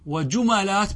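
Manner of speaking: وجملات said "wa-jumalāt", a after the m; fatha on the mim. Shown as a demonstration. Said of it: "بالفتح". (وجملات بفتح الميم)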